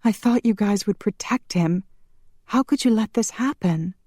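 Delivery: high, distressed accusation; tearful reproach. A narrator's reading. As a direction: reproach them through grief. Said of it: "Tears streamed down Shanna's face".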